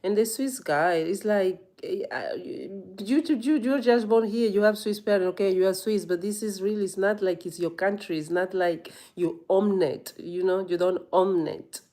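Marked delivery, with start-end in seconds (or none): none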